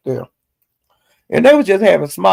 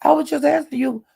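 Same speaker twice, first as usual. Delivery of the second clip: high, whiny mock protest; squeaky put-upon voice insisting he meant no harm; imitating Jay Will; 9 semitones higher than usual. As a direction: pitch it way up, offended and defensive. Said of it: "Jay Will said".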